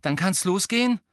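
high, loud eager question; keen to get started. Acted. Eager question before the plan.

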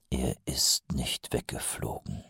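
hushed, slow whisper of a thought; inner thought whispered. A narrator's reading. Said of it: "dachte Mumm". (leise geflüsterter Gedanke)

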